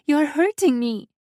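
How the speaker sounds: high, loud plea of pain; pained cry. A narrator's reading. Valeska pleads as he squeezes, high pained cry. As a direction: cry out against the grip.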